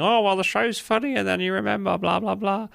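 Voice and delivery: high, sing-song mock quote of oneself; self-mocking. Host mocking what they said earlier, high self-mocking voice. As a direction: parrot yourself in a silly high voice. Mocks own earlier opinion.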